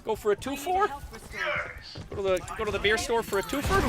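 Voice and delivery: put-on high, playful Canadian accent; full Canadian accent. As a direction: propose a beer run in broad Canadian. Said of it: "I gotta turn full Canadian here".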